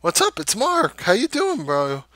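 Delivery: high, bright excited hello; eager greeting. Retold or imitated. Narrator greeting the homeless man he gives money to, eager and high. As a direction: greet him like an old buddy.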